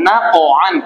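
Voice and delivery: "নাক" with the final stop bounced free, then "আন"; qalqalah demo. Shown as a demonstration. Teacher showing pupils how to read it, echoing stop. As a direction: release the end of "নাক" at once before "আন". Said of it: "কিভাবে পড়বো".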